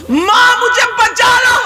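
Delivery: high, shouted cry for help; screaming for help. Acted. Girl screaming to her mother to save her, high shouted cry. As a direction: wail to be saved. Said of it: "کہہ رہی ہے".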